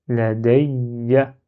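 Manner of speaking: la ya read connected, with the y of "ya" held; lingering on the shadda. Shown as a demonstration. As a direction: linger on the y in "ya" before the final a. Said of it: "je dois m'attarder un petit peu".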